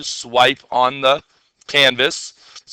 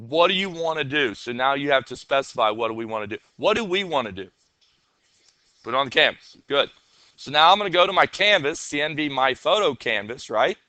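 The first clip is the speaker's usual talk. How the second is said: ordinary talk, nothing marked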